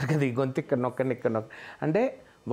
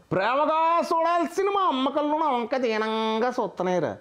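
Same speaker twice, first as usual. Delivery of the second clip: much higher comic hero voice, the tearful line made funny; Sunil doing Baahubali; 12 semitones higher than usual. Acted. Mimic redoes the Baahubali line in comic hero voice, much higher. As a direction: lift it high and play the drama for laughs. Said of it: "Prabhas గారు చెప్పిన బాహుబలి డైలాగ్ని సునీల్ గారు చెప్తే".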